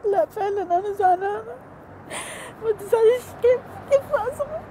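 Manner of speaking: high, tearful voice breaking into sobs; real crying, words pushed out through tears; weeping while insisting she is truly upset. Real, not acted. Breaks down about being hurt.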